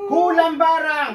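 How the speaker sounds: loud, high, drawn-out demand; slow, forceful shout; shouted interrogation. Real, not acted. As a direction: stretch the challenge out at full voice. Healer interrogates the spirit.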